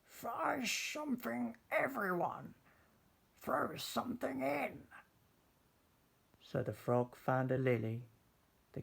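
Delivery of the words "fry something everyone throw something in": high, cheerful witch voice; witch with a grin. Acted. Witch inviting everyone to fill the cauldron, cheerful grin. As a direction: call it out grinning like a jolly witch. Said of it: "said with a grin".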